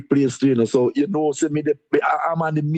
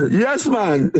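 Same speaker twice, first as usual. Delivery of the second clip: higher-pitched yes through a real laugh; laughing agreement; 4 semitones higher, 3 dB louder than usual. Real, not acted. Deputy mayor agreeing he is protecting his blood pressure, laughing. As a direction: agree heartily while laughing.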